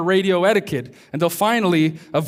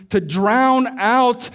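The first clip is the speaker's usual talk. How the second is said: rising preaching climax; 6 semitones higher, 4 dB louder, slower than usual. Real, not acted. Sermon builds to its climax.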